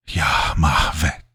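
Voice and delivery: slow, breathy demon voice; slow demonic reveal; demon naming itself. A narrator's reading. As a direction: draw the name out in a rasp. Demon gives its name.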